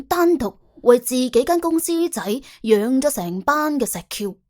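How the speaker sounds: ordinary delivery, nothing marked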